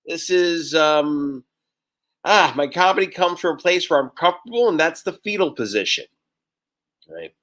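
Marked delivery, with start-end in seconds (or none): none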